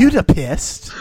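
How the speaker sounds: a light put-on Keanu Reeves voice; Keanu Reeves impression. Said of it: "That's my impression of Keanu Reeves".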